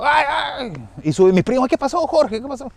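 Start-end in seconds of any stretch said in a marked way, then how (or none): 0.0-0.7 high-pitched mock frightened yelp; imitated scared cry. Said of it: "grita asustado". 1.7-2.7 high-pitched, alarmed questioning; imitated alarm. Cousins rush up after night scream.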